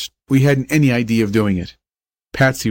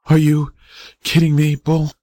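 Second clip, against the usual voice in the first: higher-pitched, halting question; hopeful disbelief; 5 semitones higher than usual. A narrator's reading. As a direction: ask it high, with small pauses, wanting to believe. Injured boy doubts the praise.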